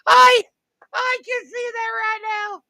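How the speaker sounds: high-pitched, breaking into real laughter; can't get the words out for laughing; laughing helplessly. Real, not acted. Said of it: "I can't stop laughing".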